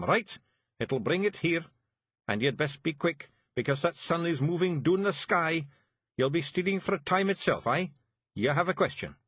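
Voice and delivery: high Scottish pixie, brisk and bossy; Feegle chief giving orders. A narrator's reading. Rob Anybody orders Daft Wullie on the raid, bossy Scottish chief. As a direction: rattle off orders to your brother.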